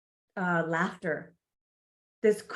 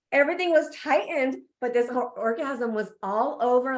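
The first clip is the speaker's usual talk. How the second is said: ordinary talk, nothing marked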